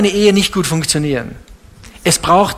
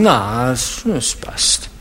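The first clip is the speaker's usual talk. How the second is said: lower, fading mock shrug; 7 semitones lower, 5 dB quieter, much slower than usual. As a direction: mumble it away like you'd rather not answer. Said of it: "Dann sagen".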